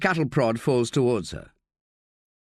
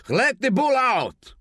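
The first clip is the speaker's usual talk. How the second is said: high, slow, commanding call; shouted command, pitched well up; 12 semitones higher, slower than usual. A narrator's reading. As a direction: bellow the order across the room. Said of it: "Quesada calls".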